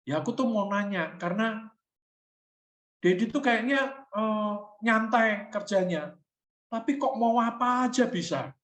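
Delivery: lightly voiced son explaining to his dad; child's voice. Child's answer voiced in story.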